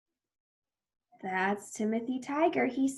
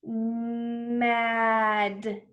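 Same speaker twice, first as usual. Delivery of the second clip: nice and slow. Shown as a demonstration. Teacher says it again for children, Mad nice and slow. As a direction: stretch every sound of Mad. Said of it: "nice and slow".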